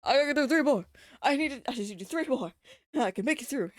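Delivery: loud, fast self-pep talk; psyching yourself up to finish; determined. Real, not acted. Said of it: "pushing through fatigue".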